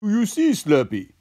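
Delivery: high character voice; Socko. A narrator's reading. Socko teaches Slurpee about throwing, high character voice. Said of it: "said Socko".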